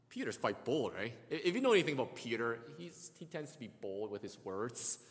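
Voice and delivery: ordinary talk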